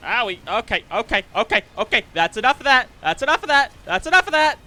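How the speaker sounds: getting hurt and pleading with the game, yelling; real pained shout. Real, not acted.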